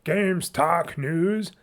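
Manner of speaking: slow, drawn-out robotic announcement. Shown as a demonstration. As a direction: imitate an old robot-voiced jingle, stretching each word. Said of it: "I did that whole robot voice".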